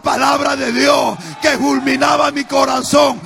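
high, loud fiery preaching; preaching at full force. Acted. Preacher retells his wife's rebuke to the congregation, fiery preaching. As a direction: thunder it out like a pulpit sermon.

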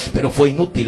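ordinary delivery

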